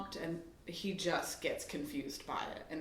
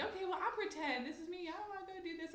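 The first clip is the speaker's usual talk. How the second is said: much higher, soft sing-song; pretend play voice; 12 semitones higher, 6 dB quieter than usual. Mom demonstrating pretend to son, high sing-song. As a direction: playfully act it out for a child. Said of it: "but I was like".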